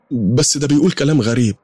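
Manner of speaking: high-pitched indignant protest; shocked objection. Acted. Daughter objects to praying backwards, shocked and high-pitched. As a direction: question it in rising disbelief.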